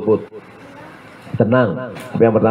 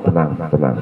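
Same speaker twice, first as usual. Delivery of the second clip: 1 low calm husband; 7 semitones lower, 3 dB quieter than usual. Retold or imitated. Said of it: "husband calms wife".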